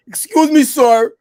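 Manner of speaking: put-on a loud, high, thick Guyanese accent asking the lecturer; a Guyanese student at the back calls out his question in his thick accent. Guyanese student in a lecture hall asking a question, thick Guyanese accent. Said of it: "my thick Guyanese accent".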